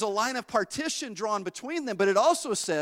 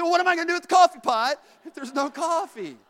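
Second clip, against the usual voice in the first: higher, louder, indignant question; exasperated disbelief; 8 semitones higher, 6 dB louder than usual. Retold or imitated. To mother-in-law with no coffee, mock indignant. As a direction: throw up your hands in mock disbelief.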